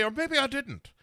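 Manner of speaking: put-on Irish accent trailing off coyly; bad Irish accent. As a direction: toss it off in a cheesy Irish accent. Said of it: "he's the one I inherited this Irish accent from".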